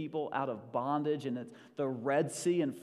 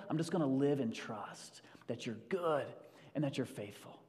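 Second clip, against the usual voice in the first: normal talking voice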